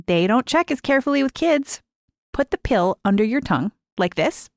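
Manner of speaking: put-on young girl. A narrator's reading. Lise showing Mary how to hide the pill, eager. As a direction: coax a friend into a secret plan.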